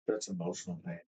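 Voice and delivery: ordinary delivery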